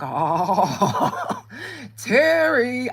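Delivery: loud, high laughing "Terry"; laughing call for a missing husband. Retold or imitated. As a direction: call his name through a laugh.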